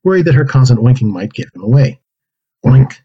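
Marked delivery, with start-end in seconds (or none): 2.7-2.9 pig oink. Acted. Pig oinks again.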